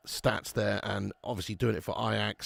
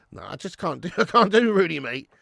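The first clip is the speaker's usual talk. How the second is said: usual speaking voice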